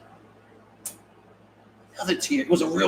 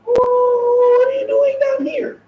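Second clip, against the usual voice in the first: mock squeal; 3 dB louder, much slower than usual. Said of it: "they're like".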